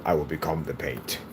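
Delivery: low mock-solemn deep voice; imitating a deep-voiced proclamation. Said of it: "hear me deep voice go".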